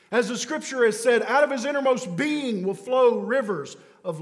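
loud, high, fervent sermon delivery; preaching at full force. Acted. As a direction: press every line on the crowd like eternity hangs on it.

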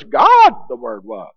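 lightly attempted Yoda voice, low-key; a modest stab at talking like Yoda; half-hearted Yoda impression, understated. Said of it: "That's my best Yoda".

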